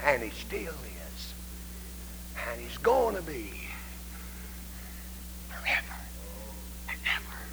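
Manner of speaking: soft and slow, trailing to a whisper; hushed awe. Retold or imitated. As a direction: hold it in hushed reverence. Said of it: "She said".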